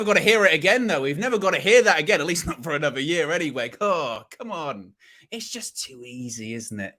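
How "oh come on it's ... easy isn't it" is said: smug gloating. Real, not acted. Gloating over rival fans.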